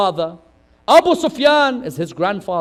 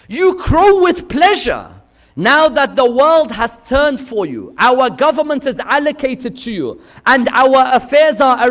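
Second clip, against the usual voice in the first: preaching climax; 3 semitones higher, 7 dB louder than usual. Acted. Preacher building to Zainab's sermon, loud. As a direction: lift it up to the peak.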